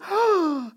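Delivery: high, sharp mock gasp; mock exclamatory gasp. Shown as a demonstration. Pastor showing what Thomas didn't do, mock gasp. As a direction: gasp as if astonished, for show. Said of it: "It's not an exclamation".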